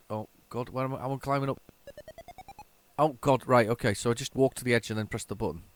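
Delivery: normal talking voice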